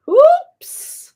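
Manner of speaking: high, loud yelp of alarm; a startled little frog's cry; loud, high "Oops!"; surprised cry. Acted. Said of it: "cried Froggy".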